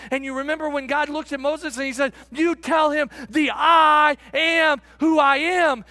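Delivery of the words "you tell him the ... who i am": loud, slow, emphatic proclamation; booming declaration. Acted. Preacher voicing God's answer to Moses, loud and declaring. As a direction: proclaim it loudly, stretching the key words.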